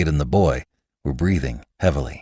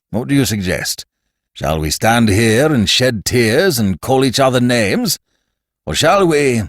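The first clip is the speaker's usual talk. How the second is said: lightly voiced fat man, louder and teasing; jovial challenge; 6 semitones higher, 5 dB louder than usual. A narrator's reading. Fat man to the Levantine, jovially goading. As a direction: goad him playfully.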